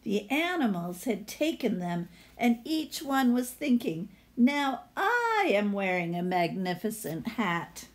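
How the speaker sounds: lively read-aloud storyteller voice; story narration. Acted. Teacher reading a picture book to her class, storytelling voice.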